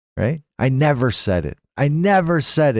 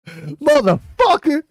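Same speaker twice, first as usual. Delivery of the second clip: angry curse; 10 semitones higher, slower than usual. Retold or imitated. Yelling at slow driver.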